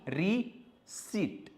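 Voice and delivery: Receipt stretched very slowly; drawn out. Shown as a demonstration. Teacher drills receipt for students, very slowly. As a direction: pull receipt out long and slow. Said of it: "drill of the word".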